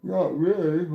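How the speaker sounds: talking through a big yawn, high and loud. Real, not acted. Streamer yawns mid-sentence while chatting about the dog, yawning. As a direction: let a loud, high yawn swallow the words. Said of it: "I yawned".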